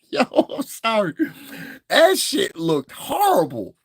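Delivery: usual speaking voice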